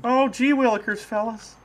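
high, hammed-up aw-shucks kid; gee-whiz voice. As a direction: squeak it like an old-timey boy. Hokey exclamation after bamboozled.

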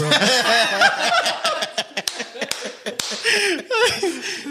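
long high-pitched cackling laugh. Real, not acted. Retelling the brain freeze to co-host, high laugh. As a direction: let the laugh run up high.

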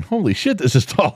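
high burst of amazement, then settles; surprised exclaim. Retold or imitated. Looking at the tall workbench in shock, surprised. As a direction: gasp at how tall it is.

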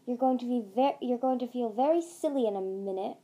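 plain reading voice

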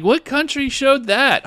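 high, slow disbelieving question; who would air that; stunned, drawn-out question; incredulous. Retold or imitated. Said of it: "I'm like".

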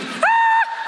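high scream breaking into a laugh; mock shriek with a laugh. Comedian reenacting his freefall scream, laughing.

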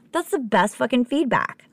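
voiced, high-pitched enthusiasm; emphatic delight. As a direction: say it again fully voiced and thrilled. Repeats delighted reaction with emphasis.

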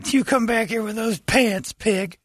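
high, slow mock yell; yelling after a pig. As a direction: shout after the thief pig. Voicing the naked man chasing.

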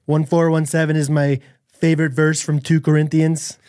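halting impression of a boastful politician; mock presidential delivery; Donald Trump. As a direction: read it out as the politician, pausing between phrases. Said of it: "do it in a Trump impression".

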